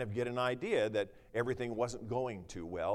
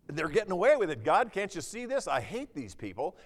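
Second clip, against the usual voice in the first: higher and louder, indignant at the wicked; outraged psalmist; 8 semitones higher, 7 dB louder than usual. Acted. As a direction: cry out to God against them.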